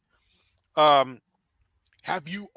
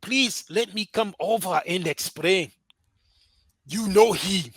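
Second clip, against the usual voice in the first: slow, pleading mock African accent; hammy African accent, begging to explain; exaggerated African accent, pleading; bad African accent; slower than usual. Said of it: "go back to our bad African accent".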